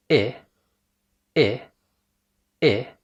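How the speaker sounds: "إيه" as short ih, close to Arabic kasra; isolated "إيه" as the vowel in sit; short English I. Shown as a demonstration. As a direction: smile slightly on a brief "إيه", sounding ih. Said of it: "قريبة جدًا من الكسرة العربي".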